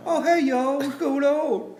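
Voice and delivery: high, laughing Southern drawl; mock Southern accent. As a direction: ham up a Southern greeting through laughter. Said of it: "how I talk like when I'm from the South".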